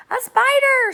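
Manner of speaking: high shrill-pitched shriek of alarm; shrieking cry. A narrator's reading. Aunt Sponge spotting a spider on the ceiling, shrieking. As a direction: scream it out at the sight of a spider. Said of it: "she cried".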